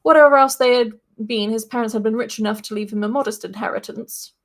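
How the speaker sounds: ordinary talk, nothing marked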